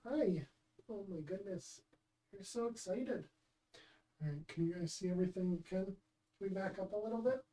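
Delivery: pet talk. Real, not acted. Coaxing the cat with treats.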